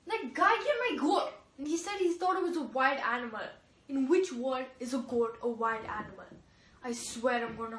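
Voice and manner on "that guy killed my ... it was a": bang out the accusation; angry complaint. Acted. Goat owner tells Sophie about the killer, angry.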